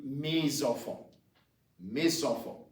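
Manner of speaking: "mes enfants" said may-zahn-FAHN, nasal vowels; French "mes enfants" with nasal vowels; pronunciation model. Shown as a demonstration. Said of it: "you would say".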